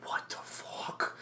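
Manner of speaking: slow stunned whisper; shocked whisper. Retold or imitated. Retelling the audience reacting to the Red Skull, whispered. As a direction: breathe it out in disbelief. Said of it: "people were like".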